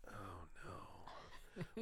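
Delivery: hushed, whispered dread. Retold or imitated. Dad realising the ride is starting, dreading whisper. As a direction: let it sink out in a whisper.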